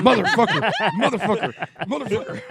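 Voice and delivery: high laughing cursing; faking pain. Pretend. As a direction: swear through laughter, pretending hurt.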